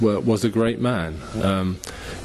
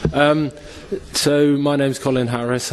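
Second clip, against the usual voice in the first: raised voice to carry over a weak mic; speaking up louder; 6 semitones higher than usual. Real, not acted. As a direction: push the voice out so the room hears. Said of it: "I'll shout down this thing".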